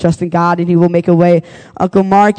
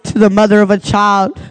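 higher preacher's litany with a refrain; altar call; 5 semitones higher than usual. Acted.

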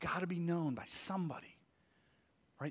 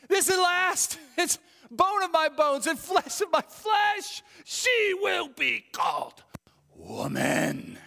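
ecstatic, much higher and louder, slowed; joyful shout; 13 semitones higher, 8 dB louder, slower than usual. Retold or imitated. Adam meeting Eve, shouting with joy. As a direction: cry it out like a man overjoyed. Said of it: "It's meant to".

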